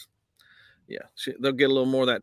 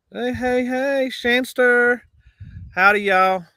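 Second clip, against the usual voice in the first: much higher, stretched-out hey hey hey; excited greeting; 10 semitones higher, 6 dB louder, slower than usual. Real, not acted. Greeting a regular viewer in the chat, high and drawn out. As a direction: sing out the hellos, drawn out slowly.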